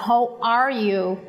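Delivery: "How are you?" said very slowly, with a strong American R in "are"; rolled R in English. Shown as a demonstration. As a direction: drag out "How are you?" and lean hard on the R of "are". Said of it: "ele puxa muito esse R". ("How are you?" bem devagar, puxando forte o R)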